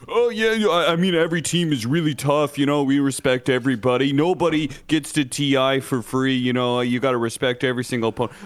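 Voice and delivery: high, fake-polite press voice; sugary fake respect for the cameras. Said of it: "on the outside, of course, they're like".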